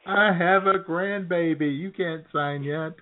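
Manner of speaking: high, slow doting granny; mock grandma. Cohost joins the grandma bit, high and slow. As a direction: drag it out like a proud grandma.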